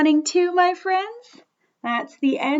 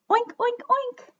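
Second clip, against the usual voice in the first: high-pitched pretend pig oinks; piggy oinks; 9 semitones higher than usual. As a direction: oink like a little piggy. Imitates a little piggy.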